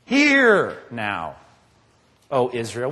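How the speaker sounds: low, slow and weighty on hear; solemn emphasis. Shown as a demonstration. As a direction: lean hard on the first word HEAR. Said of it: "First word".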